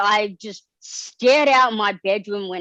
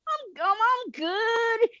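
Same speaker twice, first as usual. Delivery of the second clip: much higher, slow, stammering squeak of nerves; failed cool; 13 semitones higher, 4 dB quieter, much slower than usual. Retold or imitated. Fan meeting his childhood hero, nervous squeaky high reply. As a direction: try to sound cool but the voice cracks up high. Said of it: "trying to play it completely cool".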